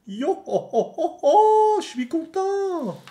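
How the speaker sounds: very high, slow yohoho laugh; skeleton Brook laugh. Acted. Streamer voicing the skeleton's joke with a very high yohoho laugh. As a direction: cackle high like a joking skeleton.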